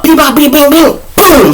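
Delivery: high, quick beeps ending in a boom; mimicked fight noises. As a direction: act out a beating in sound effects. Mimics punches and slam.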